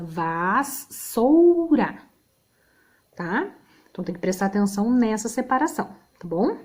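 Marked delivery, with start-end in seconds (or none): 0.0-2.0 Vassoura broken slowly into syllables, vas-sou-ra. Shown as a demonstration. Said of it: "mesma coisa". (Vassoura separada em sílabas, bem devagar)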